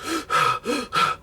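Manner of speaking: sharp high inhale for air; mock gasp. Comedian acts out the son gasping as he removes his mask. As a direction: gulp air after the mask comes off.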